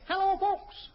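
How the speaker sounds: usual speaking voice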